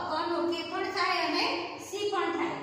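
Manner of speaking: ordinary delivery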